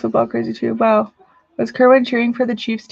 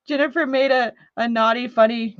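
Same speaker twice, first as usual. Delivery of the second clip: ordinary talk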